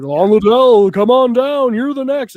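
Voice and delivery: game show announcer. Retold or imitated. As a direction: summon a contestant at full volume. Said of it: "they called him down".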